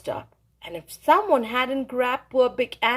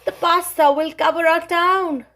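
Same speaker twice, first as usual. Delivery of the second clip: crying out in fear; 5 semitones higher, 5 dB louder than usual. Acted. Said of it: "they cried".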